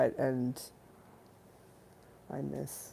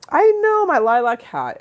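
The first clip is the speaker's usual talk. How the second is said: much higher, loud and gleeful; high excited squeal; 10 semitones higher, 9 dB louder than usual. Real, not acted. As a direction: squeal about the hat.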